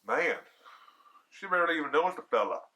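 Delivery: plain reading voice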